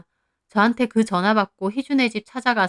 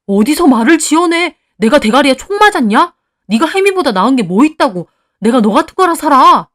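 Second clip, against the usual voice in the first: loud, raised-pitch scornful tirade; angry, insulting quarrel; 6 semitones higher, 10 dB louder than usual. A narrator's reading. Husband turns on Heeju, yelling scornful insults. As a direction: shout the lover down with contempt. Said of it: "둘이 대놓고 욕하며 싸우더라고요".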